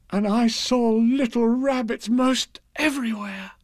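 delighted. A narrator's reading.

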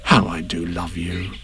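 slow, low, soft affection; tender. A narrator's reading. Christopher Robin to his bear, soft slow tenderness. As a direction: let it sink into gentle fondness.

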